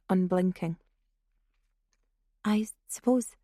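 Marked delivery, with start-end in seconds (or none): 2.4-3.4 put-on uncertain, tentative girl's voice rising at the end; a touch of nervous hesitation, trailing up; hesitant otter. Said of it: "glanced around nervously".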